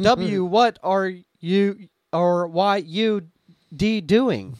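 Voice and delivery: "W" and "D" read out as letter names before "what" and "doing"; slow, loud machine reading, spelling "W" and "D" aloud; text-to-speech stutter. Shown as a demonstration. Imitating text-to-speech botching a stutter, "W" and "D" said as letters. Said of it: "the text-to-speech just goes".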